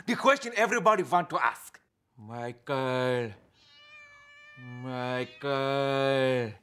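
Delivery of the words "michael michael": low, drawn-out hypnotist's call. Acted. [name] putting Michael under hypnosis, low hypnotic voice. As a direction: softly put him under, deep and slow. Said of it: "I hypnotized Michael".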